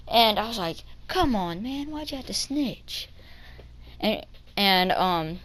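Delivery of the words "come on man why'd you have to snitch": soft, higher retold whine; muted protest. Boy to the girl who snitched, muted mock protest. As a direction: complain under your breath, as if retelling it.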